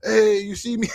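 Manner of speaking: mock-call from the stands to a player; eager fan calling out. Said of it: "I'm gonna be that dude going over like".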